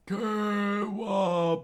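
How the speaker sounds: high, drawn-out cry; Chess and Marimo screaming. Acted. Henchmen crying out to their king, screamed. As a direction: wail the name out. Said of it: "screamed out".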